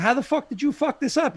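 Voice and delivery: high indignant fan rant; mock outraged fan. Fans scolding Tarantino over a flop, high and outraged. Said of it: "people would be like".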